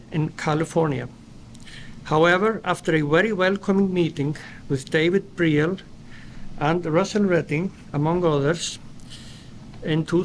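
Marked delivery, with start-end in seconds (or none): none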